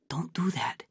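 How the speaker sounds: tense, hushed warning whisper; a frightened hiss to stop him; nervous whisper. Acted. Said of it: "he said nervously".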